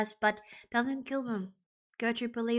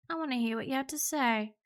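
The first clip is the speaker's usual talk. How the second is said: usual speaking voice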